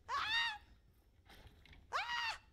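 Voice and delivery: two very high, loud shrieks; real high-pitched screams of fright; startled scream. Real, not acted. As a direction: scream as you are caught off guard. Startled in game.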